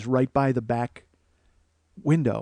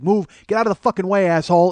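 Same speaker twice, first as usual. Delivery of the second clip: fast, loud, higher-pitched order; raised-voice road rage, rapid; angry mom; 6 semitones higher, 7 dB louder, faster than usual. Retold or imitated. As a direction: imitate a furious mother at the wheel. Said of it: "my mom's like".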